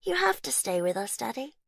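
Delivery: cling to him, creepy; eerie little girl. A narrator's reading.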